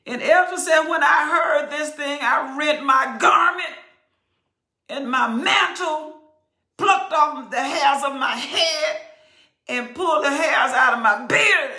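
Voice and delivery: loud, high preaching cadence; fervent preaching. Acted. Preacher to congregation, full-force preaching.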